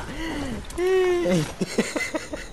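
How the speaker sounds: squeaky drawn-out laugh; high giggle. Real, not acted. Laughing mid-game.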